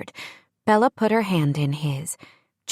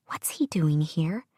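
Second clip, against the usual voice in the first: whisper; 6 dB quieter than usual. A narrator's reading. Aside to Sam about Jake.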